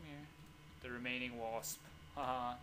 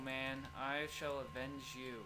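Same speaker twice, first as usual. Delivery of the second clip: ordinary delivery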